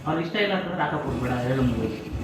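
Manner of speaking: heated argument. Real, not acted. Host defending his father to the guests, loud and heated. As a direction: argue it out loudly over the others.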